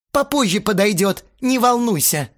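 irritated bandmate snaps back; snapping back. Acted. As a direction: snap it back at him. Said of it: "огрызнулся Астарот".

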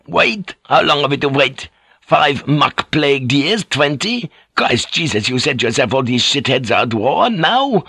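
put-on outraged sailor with a foreign accent; high-pitched incredulous outburst, accented; Fink. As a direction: cut in, aghast at the idea. Fink cuts in, outraged at waiting.

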